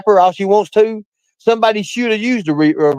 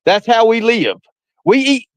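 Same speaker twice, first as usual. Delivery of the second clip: normal talking voice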